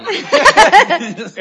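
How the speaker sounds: laughing. Real, not acted. Jokey plug for a show.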